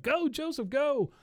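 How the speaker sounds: very high, mock-excited cheer. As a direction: cheer a teammate on in a squeaky high voice. Bobby cheering on the field.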